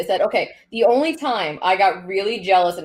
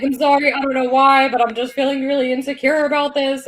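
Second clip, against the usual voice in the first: playing her panicked self; mock freaking out; 5 semitones higher than usual. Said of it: "But I freaked out".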